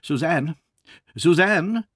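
calls his daughter's name across the house; calling out. Acted. Father calling his daughter, loud and high. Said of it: "He called".

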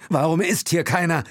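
high, loud call. A narrator's reading. Will calls out why nobody is here. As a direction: shout the question. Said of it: "rief Will".

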